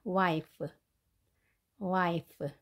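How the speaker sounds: "wife" ending in an F sound, not P. Shown as a demonstration. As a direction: finish "wife" on a clear F. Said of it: "P 발음이 아닌 F 발음입니다". ("wife" 끝을 P가 아닌 F로 발음)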